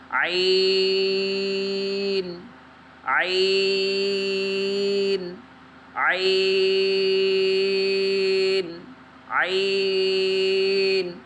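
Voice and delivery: عین stretched very long from the throat; throat letter drill. Shown as a demonstration. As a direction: practise عین slowly. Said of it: "اس کی مشق کرنی ہے".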